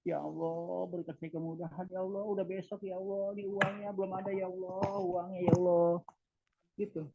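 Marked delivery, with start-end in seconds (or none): 0.1-5.9 higher, pleading prayer, money worries; whiny plea. Retold or imitated. Preacher mimics a worried pilgrim begging God, whiny plea. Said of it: "teman-teman kita yang ikut".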